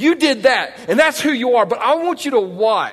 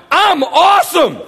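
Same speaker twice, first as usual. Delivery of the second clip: mock boast; 8 semitones higher, 5 dB louder than usual. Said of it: "our response is not".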